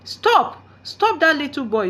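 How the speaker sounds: very high, loud shout. A narrator's reading. Villager shouting at the mob, loud. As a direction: call out to the mob to catch him. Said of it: "someone shouted".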